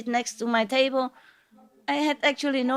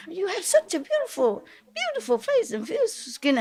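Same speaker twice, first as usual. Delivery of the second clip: high-pitched, sweet flattery; very sweet coaxing; 5 semitones higher than usual. Retold or imitated. Sweet-talking a smoking stranger about her lovely skin, very sweet and coaxing. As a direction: coax sweetly, voice climbing high on the compliments. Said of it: "I'm very sweet".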